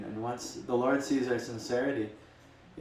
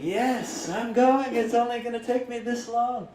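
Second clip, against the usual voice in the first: much higher, louder joyous cry; rejoicing; 13 semitones higher, 6 dB louder than usual. Retold or imitated. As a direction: burst out in delight like a freed man. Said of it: "starts rejoicing".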